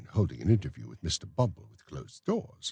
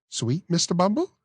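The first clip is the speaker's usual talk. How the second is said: high, prim lady's voice; genteel matron; 13 semitones higher, 5 dB louder than usual. Acted. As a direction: play the coy matron. Matron offers sugar.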